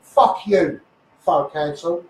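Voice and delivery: ordinary talk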